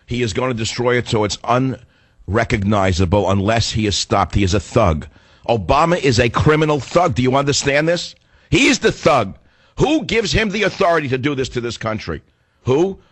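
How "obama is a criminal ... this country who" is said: high-pitched, emphatic outrage; angry tirade. Real, not acted. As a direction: hammer the accusation, then demand an answer. Angry political tirade against president.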